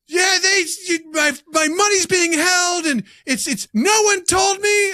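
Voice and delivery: high, loud shrieking complaint; hammed-up screeching woman on the phone; mock angry caller. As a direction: squeal it like a furious caller.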